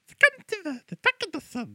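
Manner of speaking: voix de fausset aiguë et molle; voix de lavette. Retold or imitated. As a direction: joue une personne sans colonne, voix haut perchée. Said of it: "une lavette qui fait comme". (lavette soumise qui cède à tout)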